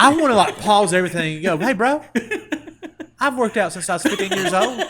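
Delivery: loud, high, laughing rant at the gym bro; half-laughing I'm-a-grown-man outburst; laughing mock rant. Annoyed at a pushy gym buddy, loud laughing rant.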